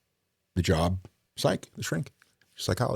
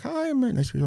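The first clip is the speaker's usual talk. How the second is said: much higher sing-song voice for her; high mock-sweet psychologist; imitating the shrink; faster than usual. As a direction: mimic her overly sweet hello.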